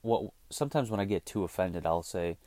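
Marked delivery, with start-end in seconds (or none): none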